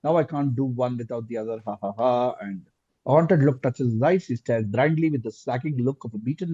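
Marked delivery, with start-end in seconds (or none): none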